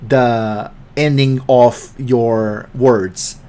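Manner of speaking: slow, every word end spelled out; drawn-out, careful enunciation of each ending; mock coaching tone, crisp word endings. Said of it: "what's the trick behind Morgan Freeman's voice".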